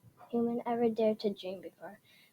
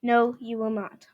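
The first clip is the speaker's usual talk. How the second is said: raven's reply; 5 dB louder than usual. Acted. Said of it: "The raven said".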